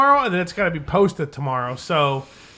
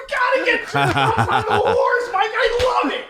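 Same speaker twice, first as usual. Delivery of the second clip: high, cracking up mid-word; laughing; 13 semitones higher than usual. Real, not acted. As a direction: laugh through the words. Howling at the hand job story.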